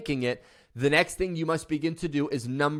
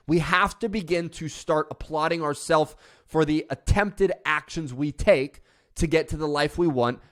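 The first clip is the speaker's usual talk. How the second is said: ordinary delivery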